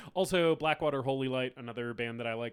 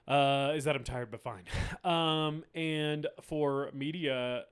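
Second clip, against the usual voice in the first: ordinary talk, nothing marked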